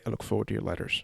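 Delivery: soft, quick Scottish lilt; a hint of Craig Ferguson's brogue; Craig Ferguson Scottish accent. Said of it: "as the great Scotsman Craig Ferguson would say".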